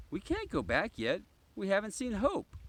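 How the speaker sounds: put-on high, eager kid's protest; excited child. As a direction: plead like a keen child who won't leave yet.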